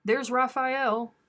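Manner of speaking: lightly voiced husband, pleased and slow; imitating John. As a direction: play John spotting the squirrel. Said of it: "John's gonna be there going".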